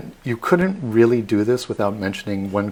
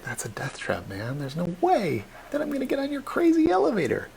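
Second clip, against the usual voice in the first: scoffing skeptics; 8 semitones higher, 5 dB quieter than usual. Retold or imitated. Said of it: "they're being like".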